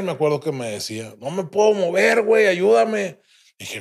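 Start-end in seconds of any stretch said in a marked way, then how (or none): 1.2-3.2 high-pitched helpless call; raised voice, begging for help; acted plea for help. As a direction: imitate an injured friend pleading. Injured friend pleads for help.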